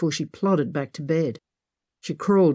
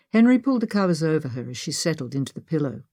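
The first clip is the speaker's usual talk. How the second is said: plain reading voice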